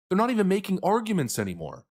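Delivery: ordinary talk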